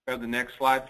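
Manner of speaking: ordinary delivery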